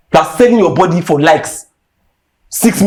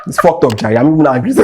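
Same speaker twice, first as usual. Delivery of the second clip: ordinary talk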